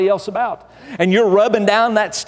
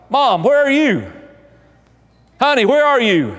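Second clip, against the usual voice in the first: calling out; 6 semitones higher than usual. Acted. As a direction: shout it down the hall, looking for her. Dad calls out for wife.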